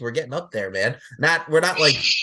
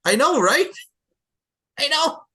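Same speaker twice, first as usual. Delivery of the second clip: excited; 6 dB louder than usual. Real, not acted. Excited agreement.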